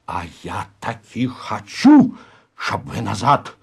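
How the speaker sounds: slow, forceful hiss; hissed insistence. Acted. Староста overrules Залетаев, hissing with force. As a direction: press each word out with menace. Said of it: "прошипел Пиня".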